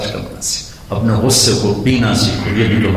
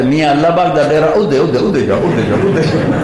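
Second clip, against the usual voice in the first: calling out; 6 semitones higher than usual. Retold or imitated. Uncle calls travelers onward.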